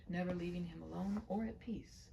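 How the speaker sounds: ordinary delivery, nothing marked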